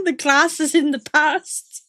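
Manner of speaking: hammed-up beginner's Scouse; Liverpool accent attempt. Shown as a demonstration. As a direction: echo the tutor in a laboured Liverpool accent. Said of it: "How to do a Liverpool accent".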